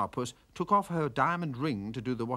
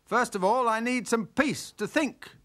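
barking back; 9 semitones higher, 4 dB louder than usual. Acted. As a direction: snap back to shut the bird up. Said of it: "Fernando barked back".

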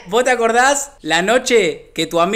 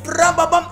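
higher mock engine sounds; higher mock engine putter; mock engine revving; 7 semitones higher, slower than usual. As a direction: rev the bike off. Imitating engine revving away.

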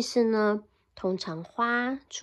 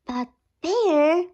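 higher, louder little mouse; pleading mouse voice; 5 semitones higher, 5 dB louder than usual. Acted. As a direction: call out to the bear. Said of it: "Mouse cried".

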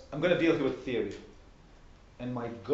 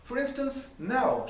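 the Queen; 11 semitones higher, much slower than usual. A narrator's reading. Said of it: "in a careless tone".

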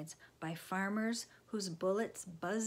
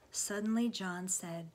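plain reading voice

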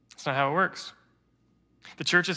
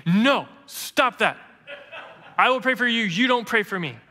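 higher, louder mock little girl, laughing through it; indignant toddler; 5 semitones higher, 4 dB louder than usual. Three-year-old niece refusing prayer, mock indignant and louder. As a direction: play the offended three-year-old, hammed up.

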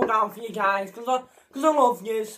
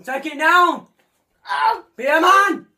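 loud, high egging-on shout; 8 semitones higher, 8 dB louder than usual. Real, not acted. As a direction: yell at them to get it down. Egging friend to eat chili.

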